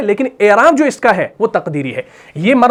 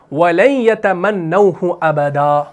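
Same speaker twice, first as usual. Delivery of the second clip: Quran recitation. Acted. Teacher recites the verse again.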